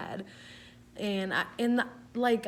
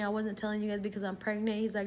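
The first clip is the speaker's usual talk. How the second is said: normal talking voice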